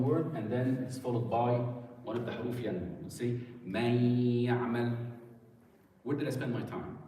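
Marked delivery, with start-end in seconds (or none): 3.6-5.0 "Man ya'mal" with the nun merged into a nasalised ya; ghunna on the ya. Shown as a demonstration. Teacher showing students idgham with ghunna, nasal merge in "Man ya'mal". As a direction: let "Man ya'mal" run together, humming on the y. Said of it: "I made the ghunna, and I spent my emphasis".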